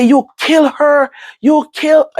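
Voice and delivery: very high, loud and slow accusation; accusing scream. Retold or imitated. Accusing hospital staff over the phone, high loud scream. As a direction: scream the blame at them. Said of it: "how my voice went on top".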